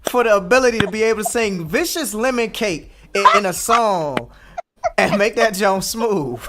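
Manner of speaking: high, loud, laughing praise; excited laughing. Real, not acted. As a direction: cheer her on through laughter. Delighted praise for the lyric.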